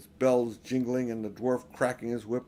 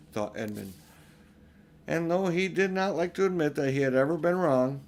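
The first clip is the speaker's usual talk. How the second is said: usual speaking voice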